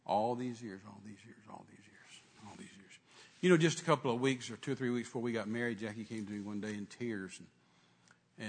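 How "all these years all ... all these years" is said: much softer and lower, fading away; hushed reflection. Real, not acted. Preacher looking back on married years, hushed and fading. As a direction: let the repeated phrase sink to near silence. Trailing repetition of a memory.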